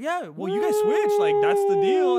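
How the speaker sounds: high, loud, insistent mock coworker voice; pushy coworker. Coworker insisting his ride is part of the deal. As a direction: push him like he owes you the ride.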